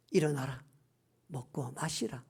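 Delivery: low, soft, hushed urging; gently retold command. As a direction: quietly rouse someone who has collapsed. Angel gently rouses collapsed Elijah.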